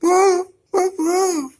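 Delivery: squeaky high-pitched whoa. Pretend. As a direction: squeal it like a startled cartoon. Reacting to game character.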